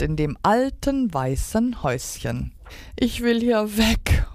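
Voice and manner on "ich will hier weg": high mock wail; wailing duck. A narrator's reading. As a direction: wail it like an upset little duck. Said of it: "heulte die Ente".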